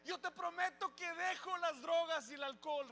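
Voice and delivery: challenging God. Retold or imitated. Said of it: "recuerdo haberle retado a Dios".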